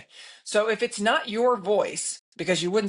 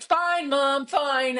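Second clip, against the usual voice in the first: imitating a daughter giving in, higher; mock grudging compliance, pitched up; 7 semitones higher, much slower than usual. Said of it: "it just snaps her out of it".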